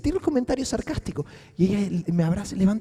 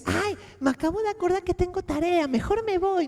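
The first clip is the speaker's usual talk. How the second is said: put-on higher, a bit faster small child; little girl voice; 9 semitones higher, 3 dB quieter than usual. Girl agreeing about the pastor, higher little girl voice. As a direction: lightly play the eager little girl. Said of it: "niña pequeña".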